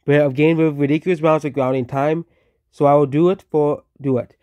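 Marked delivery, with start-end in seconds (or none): none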